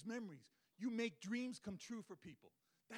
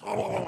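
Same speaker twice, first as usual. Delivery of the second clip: imitated roar; 8 semitones higher, 14 dB louder than usual. Hyenas challenge the lion.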